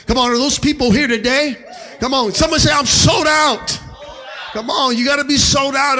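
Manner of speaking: loud call and response; preaching. Acted. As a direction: fire up the crowd. Preaching at full force.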